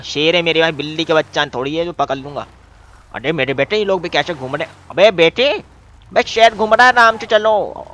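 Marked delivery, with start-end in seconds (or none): 4.9-7.9 high, loud warning call. Real, not acted. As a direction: shout the warning across. Warning people about tiger. (ऊँची आवाज़ में चेतावनी)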